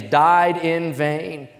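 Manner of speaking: "died in vain" drawn out very slowly and loud; very slow, weighty "died in vain"; stress on died in vain. Shown as a demonstration. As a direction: hammer each word of "died in vain". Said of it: "here's the phrase".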